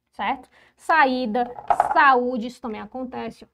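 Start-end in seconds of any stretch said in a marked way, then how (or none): 0.7-2.5 "Saída, saúde" loud, leaning on the í and ú; forceful stressed vowels in "Saída, saúde"; stressed tonic vowel. Shown as a demonstration. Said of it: "é um I pronunciado com mais força".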